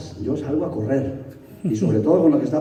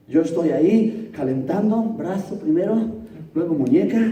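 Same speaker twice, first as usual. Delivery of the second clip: usual speaking voice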